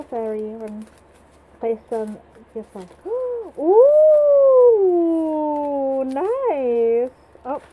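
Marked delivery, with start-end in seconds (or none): 3.0-4.7 high loud surprised gasp. Real, not acted. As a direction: gasp in shock. Reacting to game.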